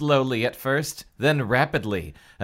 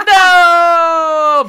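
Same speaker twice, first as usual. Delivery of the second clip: high, loud siren-like wail; 14 dB louder than usual. Acted. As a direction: wail in terror. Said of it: "wail rose through the dark room like a siren".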